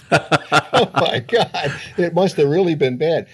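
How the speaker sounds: high-pitched, laughing disbelief. Real, not acted. Father recalling his young son's blunt answer, laughing.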